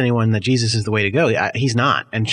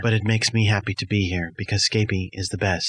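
low, even robot voice; Jordan Robot; 4 semitones lower, 4 dB quieter than usual. Acted. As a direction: answer as a subdued, devoted robot. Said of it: "I'm a robot of you".

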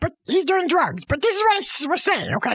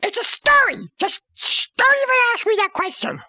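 higher, fast, flustered protest; defensive outburst; 7 semitones higher, faster than usual. Real, not acted. Storyteller snaps back at cohost's question, high and fast. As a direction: brush off the question in a rush.

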